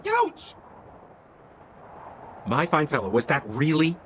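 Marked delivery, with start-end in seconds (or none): 0.1-0.5 sudden pained yelp. Acted. Speared while crawling in snow.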